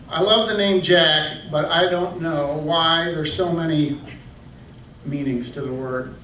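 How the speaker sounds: normal talking voice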